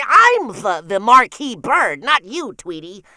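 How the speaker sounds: loud sputtering lisping duck; pushy lisping cartoon duck shouting; Daffy Duck. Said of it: "Daffy, calm down".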